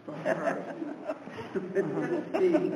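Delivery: laughing through the words; amused, laughter bubbling into speech. Real, not acted. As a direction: break up laughing mid-phrase. Amused aside before testifying.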